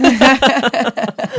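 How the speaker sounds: a loud burst of real laughter. Real, not acted. Laughing at clerk's joke.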